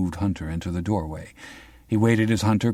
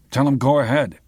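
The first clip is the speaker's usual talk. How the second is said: gruff retort; 7 semitones higher, 6 dB louder than usual. Acted. Hunter's retort.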